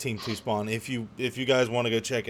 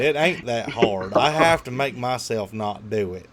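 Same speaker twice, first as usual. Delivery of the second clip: faked Southern accent; 8 dB louder than usual. Shown as a demonstration. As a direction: put on a co-host's country accent, loud and playful. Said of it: "I can fake your accent pretty good".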